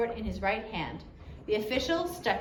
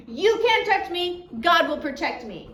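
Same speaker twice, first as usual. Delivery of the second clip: defiant repeat; 7 semitones higher, 5 dB louder than usual. A narrator's reading. Gladys repeats her desperate defiance.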